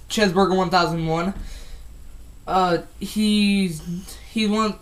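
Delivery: normal talking voice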